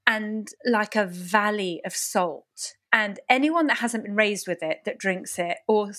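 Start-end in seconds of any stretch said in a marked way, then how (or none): none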